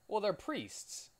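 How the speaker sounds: slow, high sarcastic remark; mock high voice. Real, not acted. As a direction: say it with a wry high lilt.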